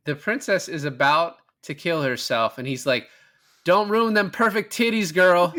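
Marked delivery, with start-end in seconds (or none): 3.6-5.5 higher and louder, hammed-up movie hero; mock swordsman voice. Retelling the movie scene to a co-host, hammed-up hero voice. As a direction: ham up the hero stopping the princess. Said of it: "I wish he said it like that".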